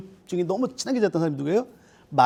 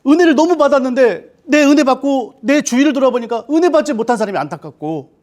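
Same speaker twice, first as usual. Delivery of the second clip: louder and higher, pressing each word; full-force preaching; 6 semitones higher, 8 dB louder than usual. Acted. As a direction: preach it with fervor to the congregation.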